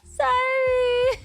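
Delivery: laughing hard mid-sentence. Real, not acted. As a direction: breaks into helpless laughter. Bursts out laughing.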